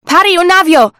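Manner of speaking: high, loud call from the rigging. A narrator's reading. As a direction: shout down to the sailors. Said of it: "rief sie den Seemännern zu".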